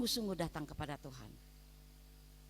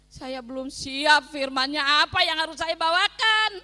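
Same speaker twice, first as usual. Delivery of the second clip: loud pleading prayer; 3 semitones higher, 15 dB louder than usual. Retold or imitated. Prays aloud to God.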